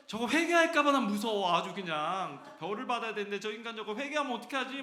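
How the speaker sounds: loud, high mock panic with a laugh in it; hammed-up dread. Preacher mimicking someone scared their enemy will repent, hammed-up dread. Acting out spiteful worry over repentance.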